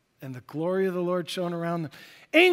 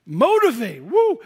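loud, high cheer; excited whoop; 5 semitones higher, 8 dB louder than usual. Real, not acted. Preacher rallying the congregation, loud excited whoop. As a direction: whoop it up for the crowd.

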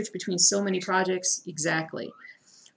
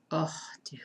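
whispered sympathy; 6 dB quieter than usual. Real, not acted. Sympathy for viewer's frogging.

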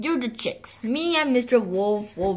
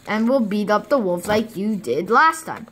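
ordinary talk

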